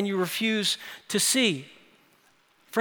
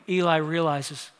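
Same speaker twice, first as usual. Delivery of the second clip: usual speaking voice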